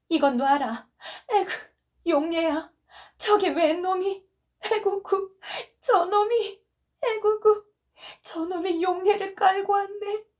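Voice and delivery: high, loud wailing cries; frantic wailing. Acted. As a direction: wail while thrashing against him. Wife thrashes, wailing. (높고 크게 몸부림치며 울부짖음)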